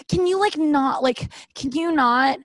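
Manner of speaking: high, annoyed brush-off; mock irritated. Woman imagining telling a partner to stop touching her hair. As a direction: shut him down, annoyed.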